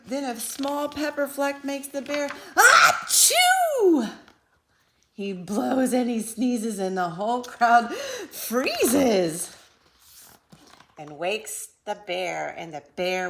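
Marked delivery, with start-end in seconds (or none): 0.1-2.3 building suspense. A narrator's reading. Building to the sneeze. 2.5-4.1 a loud high comic sneeze; huge sneeze. A narrator's reading. Bear sneezes. 5.2-9.6 loud excited telling. A narrator's reading. After the sneeze.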